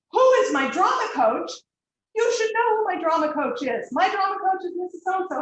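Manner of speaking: put-on high, loud, offended teenage voice; indignant girl. Girl to teacher who doesn't know her coach, high indignant outrage. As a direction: act baffled and insulted at the question. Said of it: "She looked at me like she's gonna end my life".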